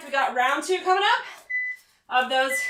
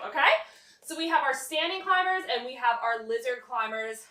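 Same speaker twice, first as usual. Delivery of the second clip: ordinary talk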